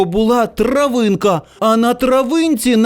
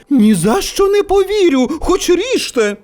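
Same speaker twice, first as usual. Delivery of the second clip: high, emphatic woman's voice; indignant mother; 8 semitones higher than usual. A narrator's reading. Friend's mother defends the boy, indignant. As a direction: protest as a disbelieving mum.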